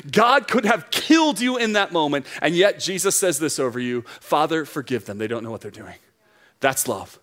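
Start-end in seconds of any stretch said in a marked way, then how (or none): 4.2-6.0 tender plea. Acted. Preacher voicing Jesus over the congregation, tender.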